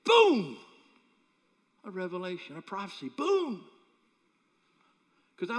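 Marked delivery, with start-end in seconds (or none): 0.0-0.6 very loud, high burst that falls; sudden booming exclamation; explosive boom. Retold or imitated. Sudden revelation imitated. 3.2-3.6 very loud, high burst that falls; sudden booming exclamation; explosive boom. Retold or imitated. Sudden revelation imitated.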